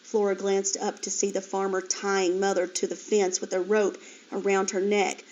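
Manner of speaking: ordinary delivery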